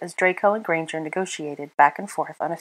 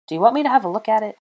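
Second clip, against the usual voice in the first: quick, high, loud blurt; 6 semitones higher, 5 dB louder, faster than usual. A narrator's reading. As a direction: blurt it out in a rush. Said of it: "blurted out".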